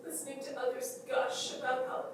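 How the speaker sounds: high, doting voice full of loss; fond, halting goodbye to a beloved pet; tender farewell. Acted. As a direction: coo lovingly upward, pausing between memories. Tender farewell to her dead dog.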